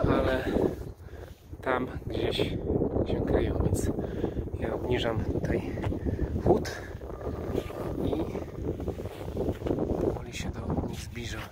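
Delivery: hushed, sinking to a whisper; hushed stalking voice fading into a whisper; sneaking quietly. Real, not acted. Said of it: "Teraz już cichutko".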